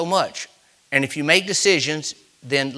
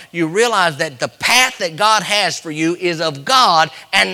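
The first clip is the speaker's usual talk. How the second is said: rising, emphatic pulpit exhortation; forceful preaching; 4 semitones higher, 5 dB louder than usual. Real, not acted. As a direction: drive it up to a louder peak.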